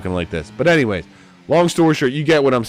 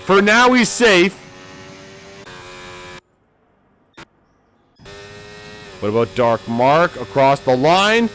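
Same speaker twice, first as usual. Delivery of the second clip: higher, urgent race call; live play-by-play; 7 semitones higher than usual. Acted. Commentator calling the qualifying bubble to viewers, raised and urgent. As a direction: keep the viewers on the edge with the bubble.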